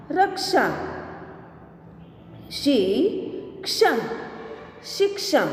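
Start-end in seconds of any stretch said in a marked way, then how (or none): 2.5-3.9 slow, each sound drawn out, rising at the end; slow drawn-out reading. Shown as a demonstration. Teacher slowly sounding out a word for students, drawn out. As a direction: stretch the word slowly for the class. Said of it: "अब इसका शब्द हम देखें".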